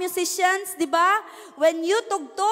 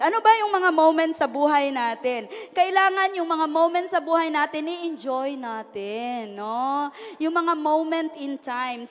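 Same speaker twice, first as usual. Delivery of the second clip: usual speaking voice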